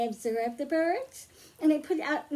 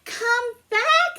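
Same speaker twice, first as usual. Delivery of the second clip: higher, louder call after the bird; 10 semitones higher, 6 dB louder than usual. Acted. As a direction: shout come back as the magician. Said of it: "says Kazam".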